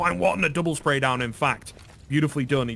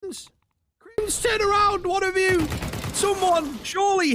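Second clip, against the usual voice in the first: esports caster; slower than usual. Real, not acted. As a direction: yell it like the round hinges on it. Caster yelling during a tense round.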